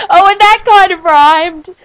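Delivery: high, loud laughing squeal; laughing delight. Real, not acted. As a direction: crack up at the accidental rhyme. Laughing at accidental rhyme.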